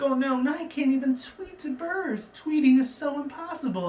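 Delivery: hammed-up despair at losing her talent; a pony lamenting she can't talk to birds; whiny Fluttershy. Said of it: "It's like".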